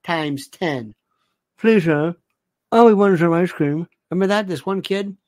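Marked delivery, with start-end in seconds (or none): 1.6-3.9 put-on underbite kid voice. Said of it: "This guy had an underbite".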